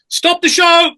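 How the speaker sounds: high, loud call from backstage; imitated urgent shout. Stage manager calling out behind the actor mid-show, urgent shout. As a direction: shout it to halt a performance. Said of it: "suddenly I heard behind me".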